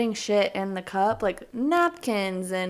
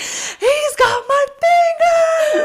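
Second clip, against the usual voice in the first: much higher, louder, slow desperate shriek; retold panicked scream; 10 dB louder, much slower than usual. Host recalling her finger bitten at Cece's, panicked drawn-out shriek. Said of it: "started screaming in the middle of Cece's".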